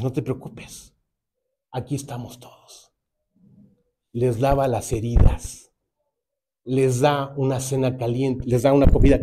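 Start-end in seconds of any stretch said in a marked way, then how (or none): 0.0-2.9 softer, gentle comforting words; calm him down quietly; Paul gently reassuring the kneeling jailer; soft reassurance. Retold or imitated.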